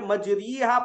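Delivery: मजरीहा with a full long ee; maroof reading. Shown as a demonstration. Teacher explaining maroof to students, मजरीहा with ee. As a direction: say मजरीहा with a clear "ree". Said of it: "मारूफ अगर पढ़े इसको".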